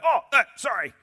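high, loud, squeaky put-on voice; mock sheepish apology. Pastor mocks our quick apology, high squeaky voice. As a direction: play the caught-out sinner. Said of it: "you ever say something like".

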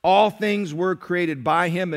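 loud, ringing scripture reading; preaching scripture. Acted. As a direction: proclaim the verses to the church.

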